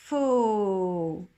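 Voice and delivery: two sounds drawn together into one; sounds blended. Shown as a demonstration. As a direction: glide the sounds together into Faux. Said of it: "Et j'avance".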